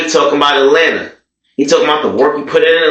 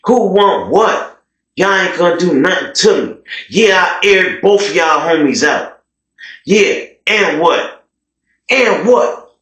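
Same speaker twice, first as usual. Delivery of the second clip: taunting challenge; 6 semitones higher than usual. Retold or imitated. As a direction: dare the whole room to step up. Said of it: "He talking to the Crips".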